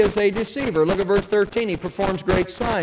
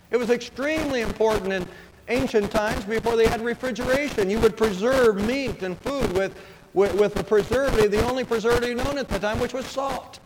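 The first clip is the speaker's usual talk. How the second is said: ordinary delivery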